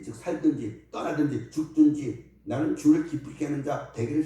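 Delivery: preaching. Acted. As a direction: preach it out to the congregation. Preacher reading scripture, exhorting. (힘 있는 설교조)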